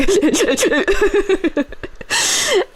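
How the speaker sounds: high, stuttering mock blubber; wordless babble through sobs, acted out; imitated incoherent sobbing. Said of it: "trying to speak through my sobs".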